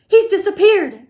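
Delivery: high, very loud yell. A narrator's reading. Mother losing sight of son, shocked yell. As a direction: cry out in shock. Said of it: "yelled Missus Gloop".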